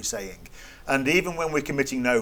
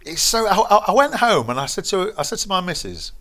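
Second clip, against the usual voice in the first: higher and louder, pressing the point; urgent insistence; 7 semitones higher, 6 dB louder than usual. Real, not acted. Answering the interviewer that it's serious, urgent and louder. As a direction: push hard that it matters.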